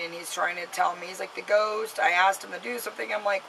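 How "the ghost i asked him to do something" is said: ordinary talk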